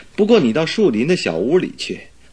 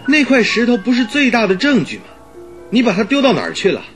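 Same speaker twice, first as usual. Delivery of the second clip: high-pitched, incredulous demand; astonished questioning; 11 semitones higher than usual. Acted. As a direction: question him in alarmed disbelief. Watson astonished the evidence is gone.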